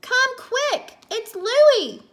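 high, loud alarmed cry; scream. A narrator's reading. Cassie calls Mommy to the kitchen, screaming. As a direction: call for help at the top of a child's voice. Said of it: "Mommy heard Cassie scream".

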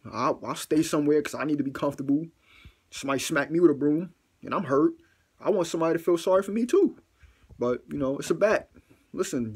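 ordinary delivery

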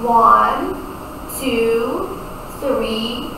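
low, drawn-out counting with the class; kindergarten teacher voice. Shown as a demonstration. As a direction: count along slowly for small kids.

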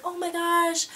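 high, put-on mortified gasp of words; imitated embarrassment. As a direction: mimic an embarrassed girl, pitched high. Acted embarrassed girl at party.

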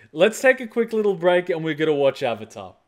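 ordinary talk

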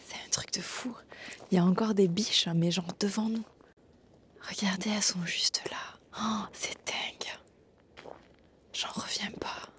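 hushed whisper in awe. Real, not acted. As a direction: whisper so the deer stay. Deer close by, not to scare them.

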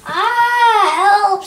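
high, loud drawn-out wail; cry for help. Acted. Percy dragged toward the shredder, loud cry for help. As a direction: scream out for rescue.

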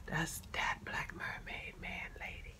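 soft, breathless whisper of awe; real hushed wonder; awed whisper. Real, not acted. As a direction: whisper it like a treasure. Awed first look at main card.

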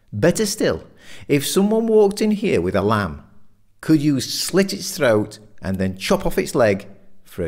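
high, insistent debating voice; provocative challenge. A narrator's reading. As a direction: press your mate hard with a pointed question. Richard challenges his meat-eating friend.

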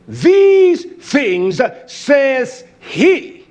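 slow, drawn-out scripture proclamation; preaching declamation. Real, not acted. Preacher proclaiming who says these things, slow declamation. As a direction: declaim each word with long, ringing weight.

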